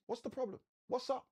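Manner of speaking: a caring angel checking in lightly; high, casual angel asking what's up. Pretend. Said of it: "The angel says unto her".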